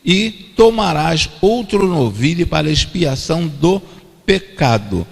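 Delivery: normal talking voice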